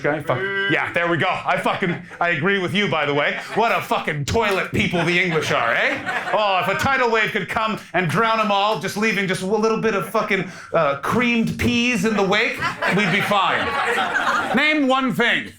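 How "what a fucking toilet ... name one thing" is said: higher, heated mock rant with a put-on accent; Northern Irish rant. Ranting at English in character.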